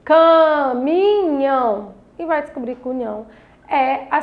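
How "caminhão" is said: CAMINHÃO stretched very slowly, each syllable held, NHÃO stressed; intense syllable reading. Shown as a demonstration. Teacher shows how to find the tonic syllable, Caminhão drawn out emphatically. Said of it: "ler com maior intensidade a palavra".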